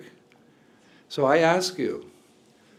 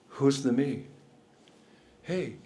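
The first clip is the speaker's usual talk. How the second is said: ordinary delivery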